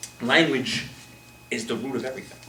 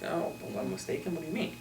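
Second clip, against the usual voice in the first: hushed defensive reaction; 4 semitones higher, 10 dB quieter than usual. Retold or imitated. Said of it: "A little bit more like".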